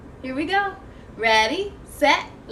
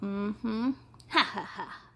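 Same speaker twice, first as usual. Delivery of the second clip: monster chuckle; 5 semitones lower, 7 dB quieter, slower than usual. Acted. Monster enjoys her trouble.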